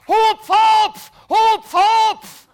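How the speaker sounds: high, loud shouted hupf from below; firemen shouting. Retold or imitated. Firemen below yelling at him to jump, high loud shout. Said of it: "die stehen ja da mit einem Sprungtuch und schreien".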